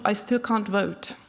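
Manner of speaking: normal talking voice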